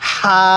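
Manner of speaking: long হা on a gentle puff; light airy release. Shown as a demonstration. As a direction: say হা with light air from the mouth. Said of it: "মুখ দিয়ে হালকা বাতাস বের করবেন".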